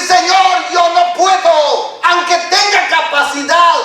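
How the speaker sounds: earnest loud prayer to the Lord; humble prayer. Acted. Preacher models a humble prayer for listeners, loud and earnest. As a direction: call out to God with fervour. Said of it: "y decir".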